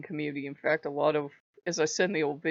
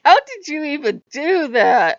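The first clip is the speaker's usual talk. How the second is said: incredulous; 9 semitones higher, 12 dB louder than usual. Retold or imitated. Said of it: "I'm like".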